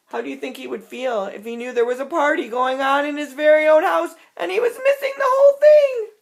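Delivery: fast, climbing to a high loud finish; excited question. Acted. Asking the children, racing up high and loud. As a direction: whip them up with the question. Asks kids about the bear.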